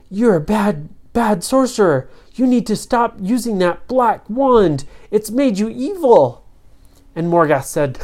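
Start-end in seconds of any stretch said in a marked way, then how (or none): 0.1-6.4 high-pitched telling-off; indignant storybook kids, pitched up; scolding. Acted. Children scold the sorcerer.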